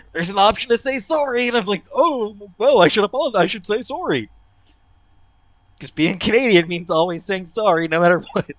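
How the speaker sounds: high-pitched, animated rant with a laugh; worked-up, laughing complaint; amused exasperation. Real, not acted. Laughing exasperation over saying sorry.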